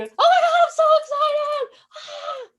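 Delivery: very high, loud squeal of excitement; hammed-up thrilled shriek; mock excitement. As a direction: squeal like you just got great news. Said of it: "or were you".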